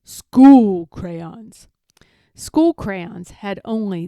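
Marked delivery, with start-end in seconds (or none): 0.0-1.7 loud, firm command; firm teacher insistence. A narrator's reading. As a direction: lay down the rule loudly.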